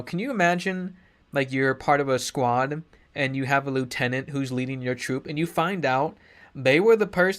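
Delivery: ordinary talk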